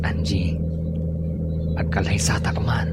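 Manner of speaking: quiet unvoiced whisper; whispered proposal. Boyfriend whispering a marriage proposal to Angie. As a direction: say it under your breath, intimate.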